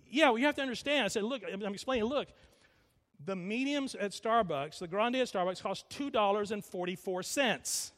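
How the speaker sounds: insistent self-defence. Retold or imitated. Husband explaining coffee prices to his wife, insistent.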